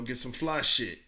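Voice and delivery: ordinary talk, nothing marked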